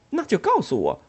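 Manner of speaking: high, loud sharp demand. Acted. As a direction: insist sharply. Ellen demanding.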